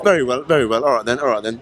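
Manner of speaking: put-on London "All right then"; London accent. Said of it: "doing the accent".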